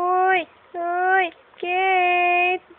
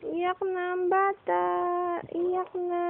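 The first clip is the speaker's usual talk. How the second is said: soft cooing; 11 dB quieter than usual. Real, not acted. Parent watching the baby start to cry, hushed cooing. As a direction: say it gently, well under your usual volume. Softly remarks on baby crying.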